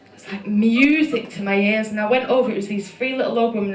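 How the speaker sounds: usual speaking voice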